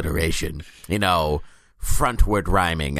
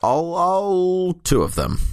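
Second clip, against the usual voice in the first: slow, high sarcastic drawl; 10 semitones higher, slower than usual. Real, not acted. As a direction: stretch it out, mocking the tiny team. Sarcastic about a tiny team.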